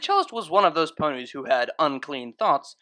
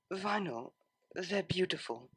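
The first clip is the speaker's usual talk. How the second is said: tender; 13 dB quieter than usual. Acted. Tender compliment, moving closer.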